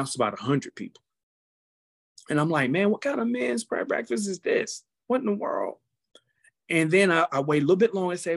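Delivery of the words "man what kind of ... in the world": high, incredulous exclamation; a high, wide-eyed what in the world; amazed. Retold or imitated. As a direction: disbelief at the crowd size. Retold own amazement at crowd.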